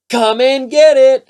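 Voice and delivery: high, loud and slow holler. A narrator's reading. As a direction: call the whole family to dinner at full voice. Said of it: "hollered Froggy".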